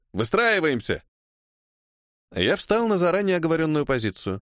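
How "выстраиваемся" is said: higher-pitched order; commanding. A narrator's reading. Assistant director lining up extras, commanding. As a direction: call the line to form up. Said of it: "командовал помощник режиссёра".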